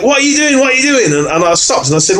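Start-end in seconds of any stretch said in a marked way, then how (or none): 0.0-1.1 high-pitched, urgent, asked twice; alarmed excited question. Retold or imitated. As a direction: rush in startled and demanding. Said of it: "he's like".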